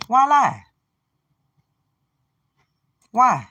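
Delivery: high, loud demand; exasperated outburst. Real, not acted. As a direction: fling the question out in frustration.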